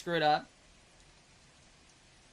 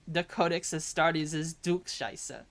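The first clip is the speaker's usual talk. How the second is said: lower, slow put-on German accent; mock German accent; 4 semitones lower, slower than usual. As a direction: play a German boy, slowly. Corrected line in German boy's voice.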